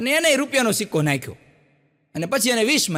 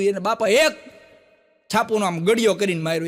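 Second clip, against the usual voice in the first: normal talking voice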